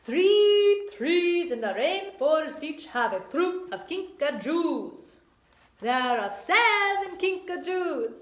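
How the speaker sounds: loud, hammed-up Italian puppet voice; theatrical mock Italian, words stretched; bad Italian accent. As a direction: read it out as a badly faked Italian. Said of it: "I gotta work on my Italian accent".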